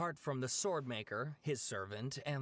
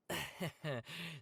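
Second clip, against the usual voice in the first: soft awkward chuckle; quiet sheepish laugh; embarrassed laugh; 3 dB quieter than usual. Acted. Laugh after exasperation.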